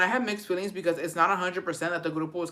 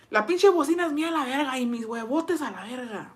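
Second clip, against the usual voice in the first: higher, mocking macho boast; mock boyfriend; 5 semitones higher than usual. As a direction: play the cocky boyfriend pitched up. Voicing boyfriend's indignant reply.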